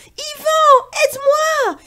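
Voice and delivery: very high, loud cry for help; straining call. Acted. Pierre calls Yvan while hauling the rope, very high and loud. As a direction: call out while pulling hard.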